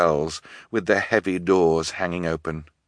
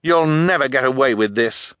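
raised, forceful challenge; defiant boy; 6 semitones higher, 4 dB louder than usual. A narrator's reading. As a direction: stand up to the villain. Boy defies his captor.

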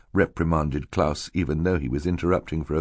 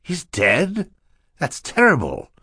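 high, startled question; shocked; 10 semitones higher, slower than usual. Acted. Mister Poe hears of the death, shocked. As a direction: react aghast to bad news.

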